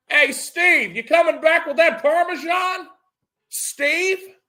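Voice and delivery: high, loud call across the house. Retold or imitated. Calling out to absent friend.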